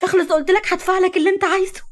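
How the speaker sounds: high, loud furious yell; angry shout. Acted. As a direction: snap at the driver in rage. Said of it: "صرخت فيه بغضب". (صرخة غاضبة عالية)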